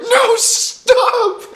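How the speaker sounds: high, loud yell breaking into laughter; laughing shout. Retold or imitated. Kids yelling at friends in the truck, laughing shout. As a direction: scream it through a laugh.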